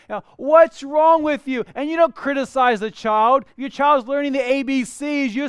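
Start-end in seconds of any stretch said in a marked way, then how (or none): 0.1-1.6 loud, high, drawn-out mock reproach; hammed-up exasperated parent telling off a toddler; mock scolding. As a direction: scold in exaggerated disbelief.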